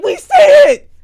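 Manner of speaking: very high, loud snap; high loud fed-up snap; angry sisters. Retold or imitated. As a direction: shriek it back at him. Sisters snapping back.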